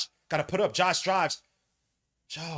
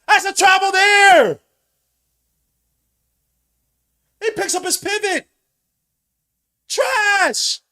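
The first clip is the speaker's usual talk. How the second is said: much higher, louder drawn-out yell; angry fan yelling travel; furious shout; 13 semitones higher, 14 dB louder, slower than usual. Real, not acted. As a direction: call the travel at full voice.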